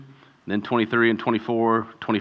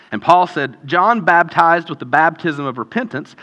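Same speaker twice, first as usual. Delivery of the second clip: raised, animated Bible reading; scripture reading; 5 semitones higher, 3 dB louder than usual. Acted. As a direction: read the passage out boldly. Reads scripture aloud.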